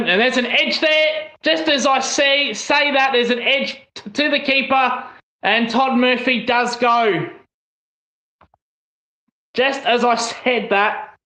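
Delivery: high, quick-fire call of the wicket falling; excited live commentary. Real, not acted. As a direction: call the edge like a commentator on air.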